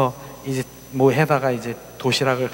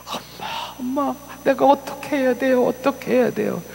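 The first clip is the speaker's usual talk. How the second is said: put-on pleading child; 10 semitones higher than usual. Sorry child begging her mother.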